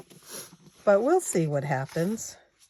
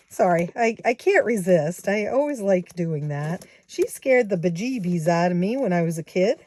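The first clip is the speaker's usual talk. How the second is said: ordinary delivery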